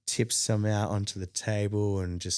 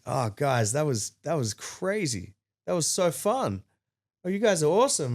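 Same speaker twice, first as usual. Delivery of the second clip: acted-out gushing, rising higher; mock gushing praise, pitched up; gushing fan; 6 semitones higher than usual. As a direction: gush like an excited fan. Stranger gushes about their set.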